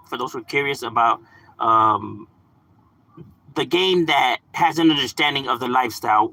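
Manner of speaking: normal talking voice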